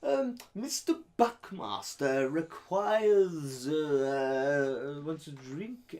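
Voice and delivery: slow, high, mock-servile minion voice; imitating a minion. S0 mimics the theatre minion asking for a drink, mock-servile. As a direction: drawl it like a nervous staff actor. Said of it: "they'd be like".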